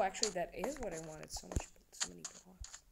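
ordinary talk, nothing marked

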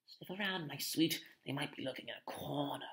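soft, sinister croon; creepy Filch. A narrator's reading. Filch urges his cat to hunt, creepy. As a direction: croon it stealthily to a pet.

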